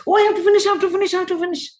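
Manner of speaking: high, fast, breathless I-have-to-finish panic; frantic rushing parent. Retold or imitated. Stressed parent rushing to finish chores, frantic and fast. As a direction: gabble it like a parent running late.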